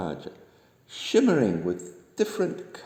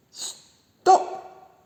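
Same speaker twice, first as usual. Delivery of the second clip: loud, breathy high cry of stop; alarmed little duck shout; 7 dB louder than usual. A narrator's reading. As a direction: yell it in a little duck's voice. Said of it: "shouted Little Duck".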